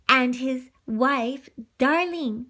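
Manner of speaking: usual speaking voice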